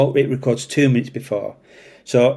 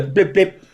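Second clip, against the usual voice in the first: high mock electronic blips; imitated blip blip; 7 semitones higher than usual. Officer imitating the in-car camera, high mock beeps. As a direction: chirp it like the camera beeping. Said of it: "I go".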